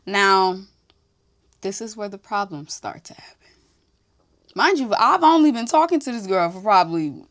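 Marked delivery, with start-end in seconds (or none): 1.6-3.5 hushed, unvoiced aside to the viewers; whisper. Real, not acted. Whispering to viewers that the trouble starts here. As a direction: lean in and whisper the warning.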